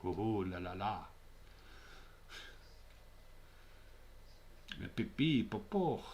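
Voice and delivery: cartoon French voice; mock French accent. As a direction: ham up a silly French accent. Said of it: "That's from Euro Trash".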